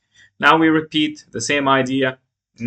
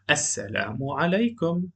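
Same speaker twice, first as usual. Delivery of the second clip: Khawla greeting, lightly voiced; a gentle greeting in the textbook dialogue; voiced dialogue line; 3 semitones higher, 5 dB quieter, faster than usual. Recorded dialogue, female voice.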